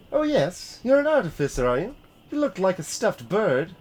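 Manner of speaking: high scornful workwoman; mocking artificer. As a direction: sneer at him, pitched well up. Grumpy artificer mocks the valet.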